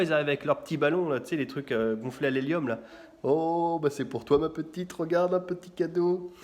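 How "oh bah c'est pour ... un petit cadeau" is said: sweet balloon seller. Retold or imitated.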